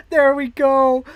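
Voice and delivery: loud, high celebration after the save; real excited shout; excited cheer. Real, not acted. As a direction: punch it out with joy.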